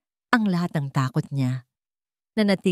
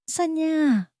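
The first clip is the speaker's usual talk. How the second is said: excited cry; 12 semitones higher than usual. Acted. Conrad cries her name in joy.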